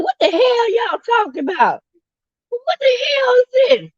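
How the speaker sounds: very high, cracking shouted outrage; imitating a Southern woman. Imitating a favourite Southern YouTuber's outraged shriek. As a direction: mimic her outraged shriek. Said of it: "she was so serious".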